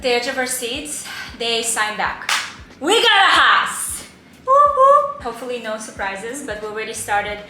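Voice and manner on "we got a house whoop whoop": much higher, loud cheer; celebrating. Real, not acted. Couple announcing to viewers they got the house, loud cheer. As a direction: cheer it out, whooping. Celebrating the deal going through.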